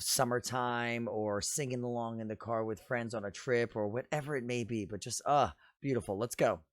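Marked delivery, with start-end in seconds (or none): none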